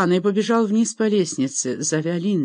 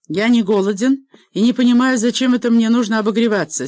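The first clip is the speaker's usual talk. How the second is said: louder, higher, brusque retort; indignant old laird, voice raised; irritated refusal; 5 semitones higher, 6 dB louder than usual. A narrator's reading. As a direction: turn down the offer crossly. Angry laird refuses hostess's invitation.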